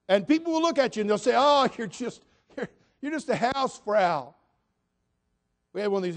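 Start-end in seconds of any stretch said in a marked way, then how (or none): 1.4-4.3 loud, high jeer imitating the critics; mocking critic. Critics belittling stay-at-home moms, mocking. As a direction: throw it out as a high, mock put-down.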